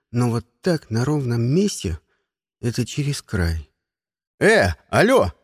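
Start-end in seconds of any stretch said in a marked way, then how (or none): 4.4-5.3 sharp bark to a whole class; rough, punchy "hey you" call. A narrator's reading. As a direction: bark it to get everyone's attention. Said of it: "рявкнул я на весь класс".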